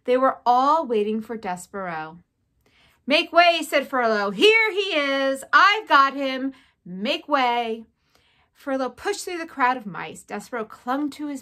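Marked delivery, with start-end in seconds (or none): 3.1-3.6 high, loud herald's call. A narrator's reading. Furlow parting the crowd of mice, calling out. 4.3-7.9 loud, drawn-out announcement; calling out. A narrator's reading. Furlow showing off his captive brother, calling out.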